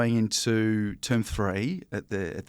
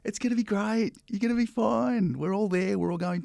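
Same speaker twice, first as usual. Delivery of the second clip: soothing parent; 11 semitones higher than usual. Retold or imitated. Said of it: "And we're like".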